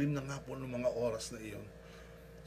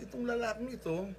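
ordinary talk

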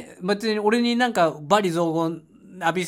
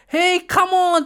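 much higher, loud "Hey, come on!"; a touch of the overexcited tourist; hyped urging; 8 semitones higher, 4 dB louder, much slower than usual. Retold or imitated. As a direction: egg the driver on. Urging the driver again.

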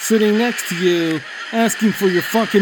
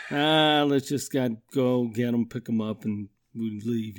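lower, softer defeated mutter; tired resigned dad; 7 semitones lower, 6 dB quieter than usual. Retold or imitated. Dad calling the kids to leave the movie, resigned mutter. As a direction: give up and sigh it out. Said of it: "calling 'em over".

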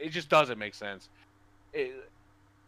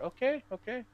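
high mock compliance; 12 semitones higher than usual. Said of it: "I'm just like".

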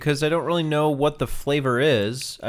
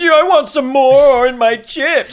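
mock whiny customer; 10 semitones higher, 8 dB louder than usual. Mocking demanding customer.

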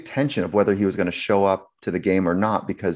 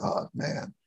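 hushed aside; 10 dB quieter than usual. Retold or imitated. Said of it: "everyone else is like".

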